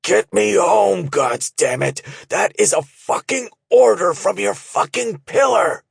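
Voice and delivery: high, loud furious order; wounded pillar ordering his men home; screaming in pain. A narrator's reading. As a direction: scream it through pain and fury. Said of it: "Hilo screamed in pain and frustration".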